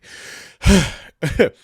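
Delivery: big exhale, fed up; loud exasperated sigh. Real, not acted. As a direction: blow out the frustration. Exasperated after rant.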